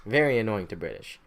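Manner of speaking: normal talking voice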